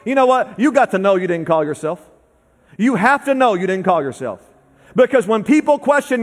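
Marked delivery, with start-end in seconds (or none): none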